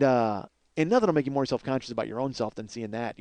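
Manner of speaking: ordinary talk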